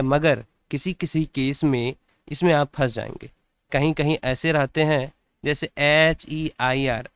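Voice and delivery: usual speaking voice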